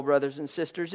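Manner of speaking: normal talking voice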